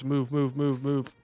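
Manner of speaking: ordinary talk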